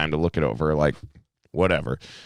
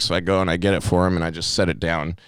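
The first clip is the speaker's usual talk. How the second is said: normal talking voice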